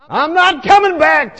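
loud, high yell back; defiant shout. Retold or imitated. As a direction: shout refusal over your shoulder. Shouts back while fleeing.

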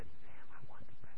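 very soft whispered request. Retold or imitated. Nurse asking a yelling patient to pray, whisper. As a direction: whisper gently under the noise. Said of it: "I'm gonna whisper".

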